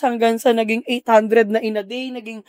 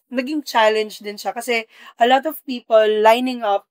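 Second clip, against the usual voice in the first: ordinary delivery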